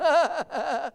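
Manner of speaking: loud, high put-on laugh; re-enacted hard laugh. Preacher re-enacts laughing over his broken furnace, hard laugh. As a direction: burst into a loud mock belly laugh. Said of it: "I was laughing so hard".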